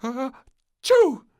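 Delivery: big loud high sneeze; the maid sneezes loudly. A narrator's reading. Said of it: "sneezed the maid".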